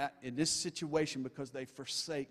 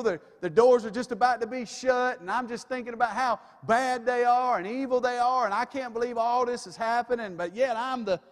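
mocking his own smugness, higher and louder; self-mocking pride; 7 semitones higher, 7 dB louder than usual. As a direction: puff yourself up in self-righteous mockery.

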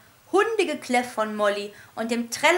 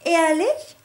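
astonished; 5 semitones higher, 6 dB louder than usual. A narrator's reading. Astonished question.

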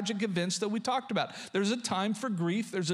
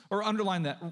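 normal talking voice

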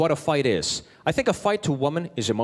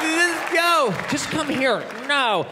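high-pitched short outbursts; acted quarrel at high pitch; heated mock argument; 8 semitones higher, much slower than usual. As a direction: fire off orders in a raised voice. Acted quarrel, pushing away.

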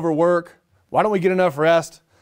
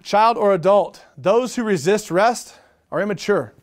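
ordinary talk, nothing marked

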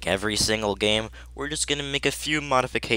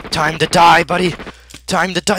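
loud high taunt; battle cry; 5 semitones higher, 9 dB louder than usual. Pretend. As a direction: yell it before the charge. Final fight taunt.

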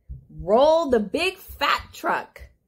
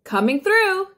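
higher, mildly raised call; mild yell; 5 semitones higher than usual. A narrator's reading. Dump truck pushing through traffic, mildly yelling. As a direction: call out to clear the road. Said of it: "yelled a dump truck".

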